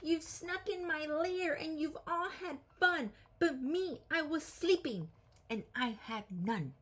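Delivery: a grumpy bear who missed out; upset bear voice. Acted. Grumpy woken bear complains.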